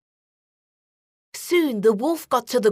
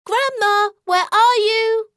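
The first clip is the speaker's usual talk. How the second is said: higher, louder call from outside; girl calling from outside, higher and louder; 10 semitones higher, 5 dB louder than usual. A narrator's reading. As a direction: call through the door like a little girl. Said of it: "called out from outside".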